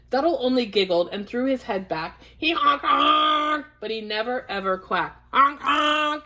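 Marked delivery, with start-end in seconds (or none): 2.5-3.7 drawn-out, raised goose honks. Acted. Duck honking like a goose. 5.3-6.3 raised, drawn-out honking; goose honk. Acted.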